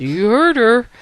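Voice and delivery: acted parental reprimand; stern dad. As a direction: play a father laying down the law. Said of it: "and Dad said".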